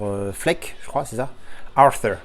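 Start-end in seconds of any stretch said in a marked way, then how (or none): 1.8-2.2 "Arthur" said the English way, with a TH; "Arthur" with an English th, not a French t; English TH attempt. Shown as a demonstration. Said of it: "T H en anglais".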